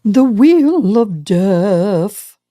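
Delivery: very slow, drawn-out doom voice; mock-ominous, very slow; ominous slow intonation. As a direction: drag out the dread like a movie trailer. Dramatic repeat, very slow.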